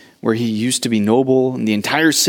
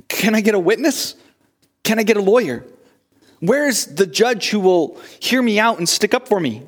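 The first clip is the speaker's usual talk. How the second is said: higher, searching plea; Job pleading; 7 semitones higher than usual. Pretend. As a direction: call out for someone to defend you.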